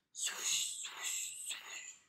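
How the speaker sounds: fading whooshing train sound, whispered; whispered swoosh. Acted. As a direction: breathe out the swooshes like a passing train. Train passing sound effect.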